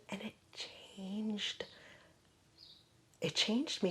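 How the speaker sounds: breathy hushed whisper, slow; whispered awe. Real, not acted. Telling a podcast host how the formula changed her, whispered. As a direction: let it drop to a whisper. Awed about a life-changing remedy.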